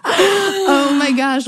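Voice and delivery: real delighted laughing outburst; laughing exclamation. Real, not acted. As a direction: gush it out through laughter. Reacting delighted to the point.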